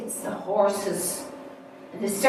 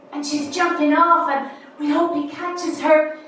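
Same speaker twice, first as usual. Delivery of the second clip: higher and louder, holding breath; excited; 7 semitones higher, 6 dB louder than usual. Acted. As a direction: build the thrill of the leap. Trapeze act, breathless suspense.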